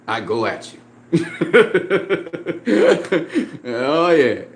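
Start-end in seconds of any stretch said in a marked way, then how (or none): 1.1-3.6 a loose laugh after the threat; real laugh. Real, not acted. Laughing at his own threat to the critic. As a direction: laugh at your own bravado.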